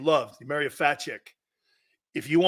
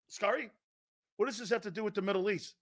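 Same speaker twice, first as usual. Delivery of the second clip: puzzled reporter; 11 dB quieter, faster than usual. Acted.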